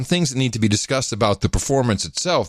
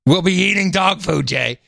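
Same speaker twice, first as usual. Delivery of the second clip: lightly voiced mom, raised pitch; a toned-down mock mother; mom impression; 5 semitones higher than usual. Host quoting mom's inevitable line, light mom impression. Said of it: "my mom would inevitably wind up saying".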